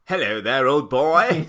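a high, loud, plummy hello; Queen's English. Pretend. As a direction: greet him like an old aristocrat. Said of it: "four, Queen's English".

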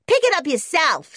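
high, loud retort; cheeky American boy. A narrator's reading. Junior refusing George's order, cheeky and loud. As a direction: answer back as a bratty kid.

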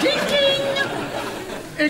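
high laughing ding ding; bell ding imitation. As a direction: chime like a call bell, laughing. Mimicking call bell.

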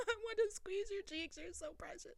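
high, soft, giggly gushing; cooing fan. Retold or imitated. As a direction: coo it as if at something adorable. Retold cooing at idol.

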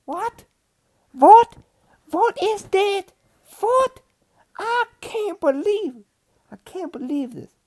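high, loud indignant what? what?; ranting at the thrift store lady, indignant; mad outburst. Retold or imitated. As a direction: sputter in outraged disbelief. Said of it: "And I walk away all mad".

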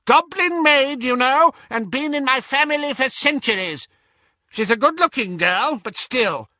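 high, loud, bad-tempered old woman; imperious elderly witch, bossy. A narrator's reading. Great-aunt demanding a good seat, high and imperious. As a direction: order them about in a high old voice.

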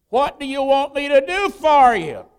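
loud, high preacher's delivery; booming question. Acted. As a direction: throw the question out at full voice. Preacher voices Jesus's question forcefully.

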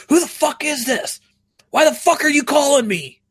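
high-pitched mock rage; screaming maniac act. Prankster answering a known telemarketer's number, hammed-up maniac yelling. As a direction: pretend to lose it like a maniac. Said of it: "I just start screaming like a maniac".

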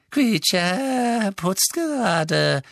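high, very slow elf whine; evasive elf. A narrator's reading. Elf repeating his excuse to the master, evasive. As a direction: repeat it with stretched, whining vowels. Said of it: "wiederholte der Elf".

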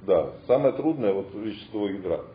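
ordinary delivery

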